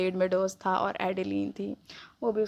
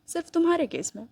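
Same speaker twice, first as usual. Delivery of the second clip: smug reply, pitch jumping much higher; higher-pitched smirking retort; 8 semitones higher than usual. A narrator's reading. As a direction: tease her with a smirk you can hear. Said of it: "Kylian ने smirk के साथ बोला".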